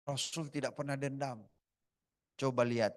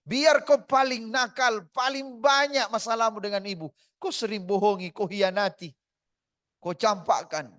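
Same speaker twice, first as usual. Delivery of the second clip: impassioned preaching; 7 semitones higher, 10 dB louder than usual. Acted. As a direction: build it like a sermon peak. Building sermon passage.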